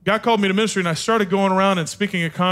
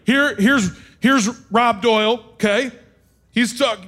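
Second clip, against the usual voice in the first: ordinary delivery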